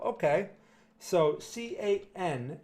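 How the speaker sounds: ordinary delivery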